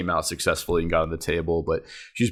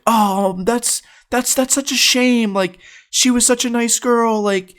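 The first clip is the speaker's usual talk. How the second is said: high-pitched put-on lament; mock sympathetic aunt. Aunt laments lost girlfriend, high mock-sorry voice. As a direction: imitate an aunt regretting it, pitched up.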